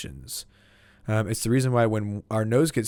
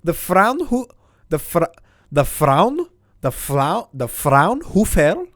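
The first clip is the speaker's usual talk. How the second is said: German accent; 6 semitones higher, 6 dB louder, slower than usual. Shown as a demonstration. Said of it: "make me do the German accent".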